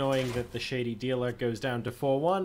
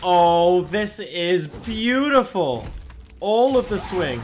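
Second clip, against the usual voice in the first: loud, higher, delighted and drawn out; gleeful excitement; 6 semitones higher, 8 dB louder, slower than usual. Real, not acted. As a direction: savour the big turn out loud. Gleeful at a big winning turn.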